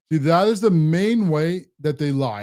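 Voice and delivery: usual speaking voice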